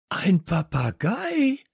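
high, drawn-out exclamation; excited cry. A narrator's reading. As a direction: cry out slowly on a high pitch. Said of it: "rief ich". (begeisterter, hoher Ausruf)

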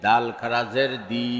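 final "দি" held long; drawn-out vowel. Shown as a demonstration. Teacher demonstrating khara jer, long "দি". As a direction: stretch the last "দি" for one alif. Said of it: "একালিপ টেনে পড়তে".

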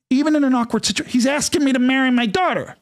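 high-pitched incredulous emphasis. Real, not acted. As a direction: lift the pitch right up in disbelief. Incredulous at the awkward moment.

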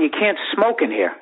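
normal talking voice

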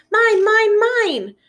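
high, loud roar of mine. Acted. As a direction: bellow it like a greedy little dinosaur. Minosaur roars his catchphrase.

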